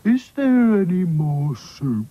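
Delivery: very slow, drawn-out doll voice; glum Sad Sack. Acted. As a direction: drag every word. Sad Sack's voice.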